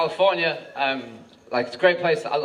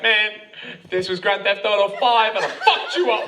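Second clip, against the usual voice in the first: put-on cocky voice, lifting at the end; mock bravado; 3 semitones higher than usual. Comic imagining himself beating the policeman, mock bravado. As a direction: act out a gamer's swaggering thought. Acts out cocky first thought.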